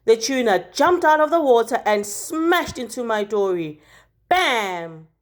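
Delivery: ordinary delivery, nothing marked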